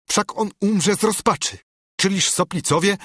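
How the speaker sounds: znacznie wyższy, przejęty głos; przejęte błaganie. A narrator's reading. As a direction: zaklinaj rozmówcę z przejęciem. Ksiądz błaga sędziego, wzburzony. (odegrane wzburzenie, wysoko)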